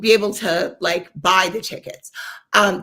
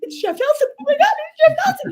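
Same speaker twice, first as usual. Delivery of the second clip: high, fast squealing disbelief; starstruck squeal; 5 dB louder, faster than usual. Retold or imitated. Fan picking up a call from her idol, squealing fast and high. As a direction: squeal it out, rushing higher.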